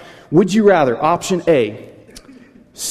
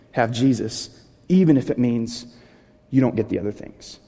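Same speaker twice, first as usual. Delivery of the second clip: 1 hushed; 4 semitones lower, 6 dB quieter than usual. Real, not acted. Sermon turns to Jesus.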